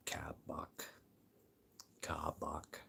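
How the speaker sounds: barely voiced, trying it out; whispered to oneself. Real, not acted. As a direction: whisper the word under the breath. Whispering the word to himself.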